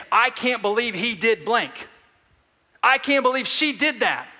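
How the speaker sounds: high, loud, outraged; mock the indignant complaint at full pitch; indignant outburst. Preacher acting out the outraged inner complaint, loud and high.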